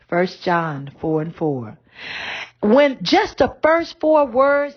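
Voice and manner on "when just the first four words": higher, louder and slower, bursting with joy; excited. Real, not acted. Preacher thrilled by a scripture's opening, excited. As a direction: let the thrill lift the voice. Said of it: "did you hear the excitement came in my voice".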